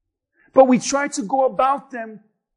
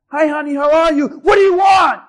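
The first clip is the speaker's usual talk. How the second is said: unwitting shouting; 3 semitones higher, 6 dB louder than usual. Acted. Preacher acting a person who doesn't know they're shouting, angry shout. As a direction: shout back at a harmless greeting, exploding on "want". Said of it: "doesn't even know that they're shouting".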